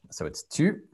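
"tu" with the front rounded French u. Shown as a demonstration. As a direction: say "tu" with lips rounded. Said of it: "this is what it sounds like".

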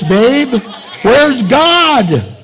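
loud, high, slow mocking jab; mock exasperated. Husband mocking his wife after the lunch, loud and high. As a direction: rub it in, exasperated and smug. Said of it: "I looked at her and I go".

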